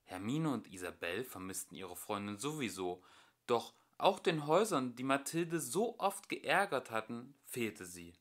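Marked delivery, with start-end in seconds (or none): none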